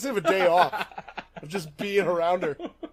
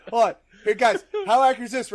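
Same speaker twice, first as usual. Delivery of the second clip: ordinary talk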